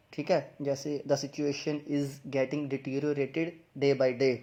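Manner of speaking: ordinary talk, nothing marked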